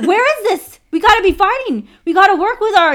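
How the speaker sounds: high, loud and urgent, imitating a friend; fired-up rallying call. Friend urging Asians to fight together, high excited call.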